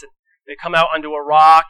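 ordinary talk

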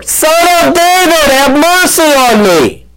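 high-pitched, insistent cry. Retold or imitated. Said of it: "He only shouted louder".

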